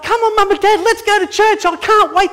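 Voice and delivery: put-on high, loud, excited kid begging to go; a little kid bouncing to get to church; eager child. Child voiced urging parents.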